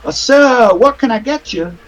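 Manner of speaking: high, bright waitress voice. Acted. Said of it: "And she goes".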